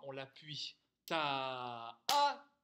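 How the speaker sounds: the second beat pushed hard and loud; accented second beat. Shown as a demonstration. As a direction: lean into the second "ta". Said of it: "Et que ce deuxième temps".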